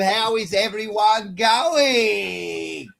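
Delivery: loud, high, drawn-out announcer welcome; show-opening shout. Real, not acted. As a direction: shout the big welcome, stretching out the words. Opens the live show.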